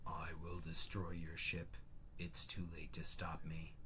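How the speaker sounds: low, quiet robot villain; mechanical voice. Acted. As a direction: read it like a cold machine. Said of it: "speak in a mechanical voice".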